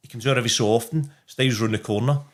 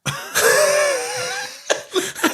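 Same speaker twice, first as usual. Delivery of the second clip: relieved laugh. Real, not acted. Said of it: "pissed myself laughing".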